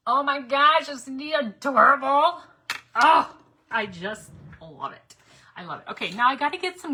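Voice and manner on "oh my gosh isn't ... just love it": higher, louder, slow gushing; slow, loud delighted gushing over the decoration. Real, not acted. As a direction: coo over the cute little figure.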